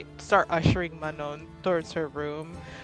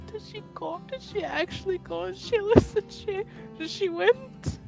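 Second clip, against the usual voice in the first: crying; 13 semitones higher than usual. Acted. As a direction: squeak it out as if crying. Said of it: "I start crying".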